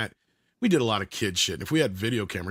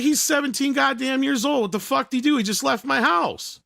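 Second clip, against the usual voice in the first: high-pitched retold outrage; indignant protest; 13 semitones higher than usual. Protesting Ryan's arrest to the cop, indignant. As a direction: argue back, voice pitched way up.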